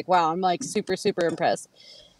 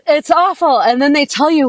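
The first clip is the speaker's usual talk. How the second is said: much higher and louder, fed up; exasperated outburst; 6 semitones higher, 8 dB louder than usual. Real, not acted. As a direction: complain loudly, voice up high. Mock outrage about men.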